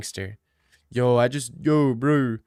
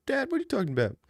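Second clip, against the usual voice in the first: much higher, baffled disbelief; incredulous; 10 semitones higher, 5 dB quieter, much faster than usual. Retold or imitated. Son reacting to his dad's explanation, baffled and high. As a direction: squeak out how absurd this is. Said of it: "And I said".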